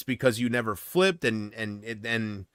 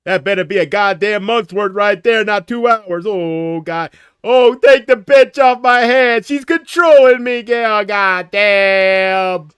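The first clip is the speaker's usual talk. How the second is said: high, very loud hammed-up yelling; over-the-top hollering, dragging out the last words; mock outraged rant; 5 semitones higher, 12 dB louder, slower than usual. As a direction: wail it at full volume in exaggerated despair. Mock rant about long messages.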